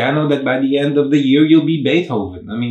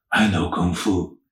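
lower, deadpan Neo line, lightly voiced; a playful stab at a laid-back movie hero, pitched down; Keanu Reeves impression; 5 semitones lower, 4 dB quieter than usual. Vlogger quoting Neo waking up, mock Keanu voice. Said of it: "my best Keanu Reeves voice ever".

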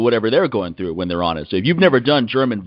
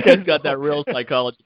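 ordinary delivery

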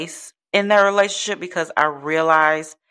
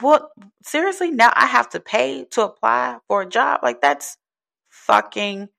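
real frustration; 6 semitones higher than usual. Real, not acted. Job seeker venting about paying to apply, frustrated. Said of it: "the level of frustration in my voice".

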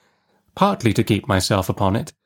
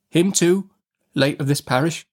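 put-on timid child voice; 7 semitones higher than usual. A narrator's reading. Boy answers.

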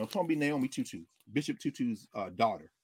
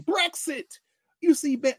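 ordinary delivery

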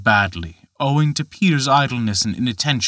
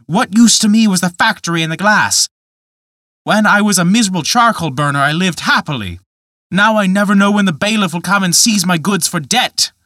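higher, louder, bitter rant; raging complaint; 7 semitones higher, 5 dB louder than usual. A narrator's reading. Peter rages at the glass man over his ruin, loud and bitter. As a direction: let the rage spill out fast in the middle. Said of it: "flew into a rage and swore".

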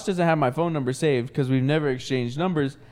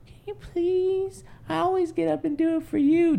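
whiny pleading voice; 13 semitones higher than usual. Retold or imitated. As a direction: beg in a high whine. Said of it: "And I'm like".

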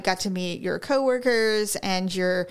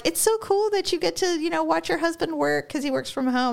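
higher-pitched mock admiration; imitated gushing; 8 semitones higher than usual. Women admiring her husband's job, imitated gushing. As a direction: gush like an impressed acquaintance, pitched up. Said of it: "they're like".

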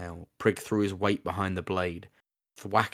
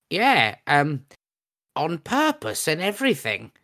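high, louder goblin voice; 9 semitones higher, 6 dB louder, slower than usual. Acted. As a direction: bluff it like a proud goblin. Goblin Scab answers, voiced character.

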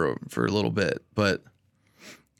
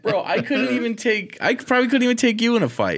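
laughing disbelief; 11 semitones higher than usual. Real, not acted. Laughing at fighting suggestion.

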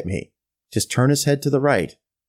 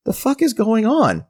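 higher-pitched baffled inner cry; retold confusion, voice pitched up; bewildered disbelief; 9 semitones higher than usual.

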